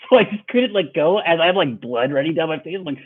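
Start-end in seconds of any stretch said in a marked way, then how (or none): none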